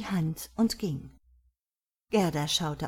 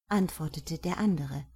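ordinary delivery

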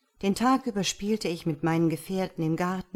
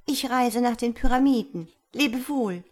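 high, louder parting call; swallow calls farewell; 6 semitones higher, 6 dB louder than usual. A narrator's reading. Swallow calls goodbye to the reed before flying off. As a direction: fling the goodbye as she leaves.

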